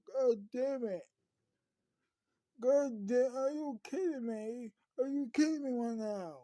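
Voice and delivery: high, loud, angry disbelief; acted fury at the bad news; exasperated outburst. Acted outburst after friend's injury.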